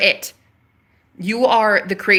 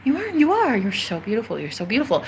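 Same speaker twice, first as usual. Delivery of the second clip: softer, gentle reassurance; soothing herself in the mirror; 5 dB quieter than usual. Retold or imitated. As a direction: coax yourself tenderly, quietly. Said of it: "And I have to be like".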